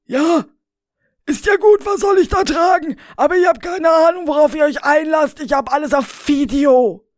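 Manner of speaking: high, loud, fast protest; exasperated Rainer. Acted. Rainer grudgingly giving in, high loud protest. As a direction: give in, then threaten.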